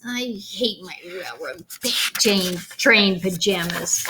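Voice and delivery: slow, unvoiced grumbling whisper; sulky whisper. Boy complaining about pajamas, slow sulky whisper. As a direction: mutter it like a boy who hates them.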